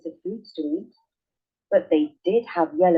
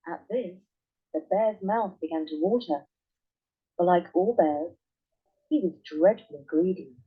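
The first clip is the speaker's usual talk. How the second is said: ordinary delivery, nothing marked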